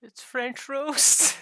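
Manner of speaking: high, slow, drawn-out shout; loud comic wail; mock indignant yell. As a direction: yell it as a hammed-up punchline, stretching the words. Acts the punchline yelled at cowboy.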